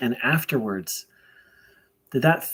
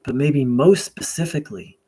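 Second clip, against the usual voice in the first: prayer invocation; 4 dB louder than usual. Real, not acted. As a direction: pray aloud deliberately, leaving long pauses between phrases.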